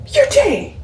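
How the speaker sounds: high squeal of recognition; excited squeal. Retold or imitated. Patient recognizing her nurse, high excited squeal. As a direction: squeal the name like a starstruck fan.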